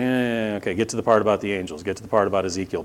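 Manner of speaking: dismissive hurry. Retold or imitated. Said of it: "Just like".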